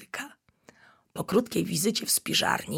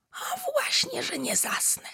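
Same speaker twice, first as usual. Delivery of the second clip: stubborn cub; 3 dB quieter than usual. Acted. Stubborn little bear cub.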